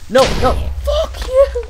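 high, loud shriek of panic; real panicked scream. Real, not acted. Gamer screaming at the creeper, real panicked shriek. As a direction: scream as the house explodes.

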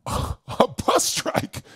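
high, slow, breathy near-whisper of disbelief; stunned echo of the news. Retold or imitated. Stranded teen hearing of the bus strike, stunned whisper.

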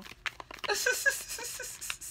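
long, high wheezing laugh; helpless laughter. Real, not acted. Reader laughing at what your ancestors say, helpless wheezy laugh. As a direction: crack up, softly wheezing.

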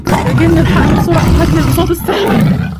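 panicked shout. Acted. Panicked call to the genie.

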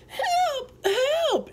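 very high cried help; screaming for help. Retold or imitated. Girl on the sidewalk screaming for help, high cry. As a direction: shriek it like a hurt girl. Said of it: "she's screaming".

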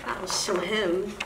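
sneaky aside. Acted. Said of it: "Leonardo snuck up".

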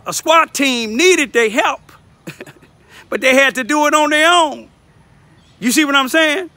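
ordinary delivery

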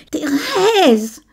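high, panicked calls for help; desperate cry. Acted. Camille chokes and cries to Thérèse for help. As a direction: cry out for her as you're strangled. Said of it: "Il voulut crier".

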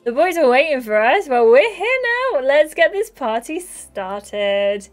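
rally everyone to start the party; excited party rallying. Acted. Girls arrive to waiting boys, excited rallying cry.

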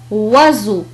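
"وزو" with voiced z between vowels. Shown as a demonstration. Teacher giving another example, z in "وزو". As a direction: say "وزو" with a buzzing middle z. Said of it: "فينطق".

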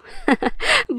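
high, light laughing burst; a bright teasing giggle. Acted. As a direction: laugh it off playfully. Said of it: "نغم بضحك قالت له".

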